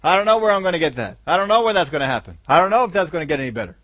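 a believer listing fears, fast mock panic; mock rapid fretting. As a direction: rush through the worries like a fretter. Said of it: "That's your hope".

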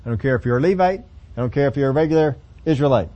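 ordinary talk, nothing marked

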